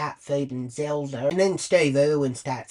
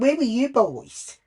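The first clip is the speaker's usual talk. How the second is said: louder, higher scolding; 6 semitones higher, 6 dB louder than usual. Retold or imitated. To the boys who ignored her calls, a loud scolding. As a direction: tear into the boys.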